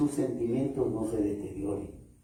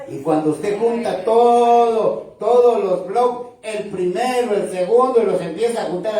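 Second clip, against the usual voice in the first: loud pastor building to a climax; preaching shout; 7 semitones higher, 7 dB louder than usual. Acted. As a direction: hammer the point home.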